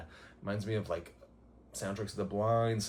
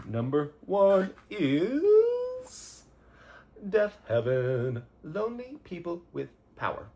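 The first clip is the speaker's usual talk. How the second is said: slow, much higher and louder announcer voice; grand reveal; 7 semitones higher, 7 dB louder, slower than usual. Pretend. Host unveils favorite album of the year, big slow announcer voice. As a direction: stretch it out like a big award reveal.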